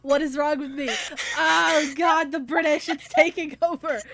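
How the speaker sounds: loud, high, laughing self-reproach; exasperated outcry. Real, not acted. Game master bemoans lost Southern accent, exasperated outcry. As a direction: wail in comic exasperation at yourself. Mock despair over her slipping accent.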